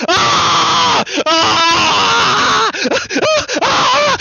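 laughing shriek. Real, not acted.

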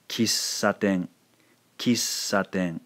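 Kissaten with the middle ss drawn out unbroken; elongated S. Shown as a demonstration. Teacher repeating the practice word, long held ss in Kissaten. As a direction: hover on the S in Kissaten before saten. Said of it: "sort of elongating the sound".

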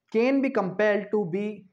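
very slow, drawn out; slow dictation. Shown as a demonstration. Law teacher reading the clause aloud, very slow. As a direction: spell out the words for the class.

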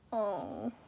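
ordinary delivery, nothing marked

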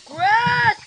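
calling the name out loud and high; urgent shout for a missing teammate. Real, not acted. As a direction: yell for your friend across the map.

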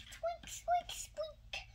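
tiny, very high squeaking voice; baby bird squeak. Acted. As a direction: squeak like a chick inside its shell. Baby squeaking inside the egg.

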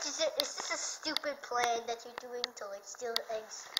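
normal talking voice

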